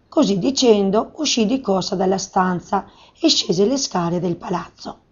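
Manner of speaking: usual speaking voice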